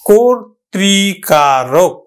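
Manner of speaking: কর্তৃকারক stretched out, first vowel as ও; very slow model word. Shown as a demonstration. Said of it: "উচ্চারণ হলো কিন্তু".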